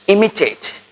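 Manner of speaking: teacher stressing imitate; emphasis on imitate. Shown as a demonstration. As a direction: imitate lifted high and stressed. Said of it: "Listen and imitate is the best way".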